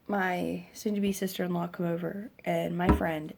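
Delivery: ordinary talk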